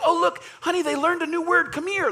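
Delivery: high mock-delighted parent call; mock proud parent. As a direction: squeal like a proud parent showing off the baby.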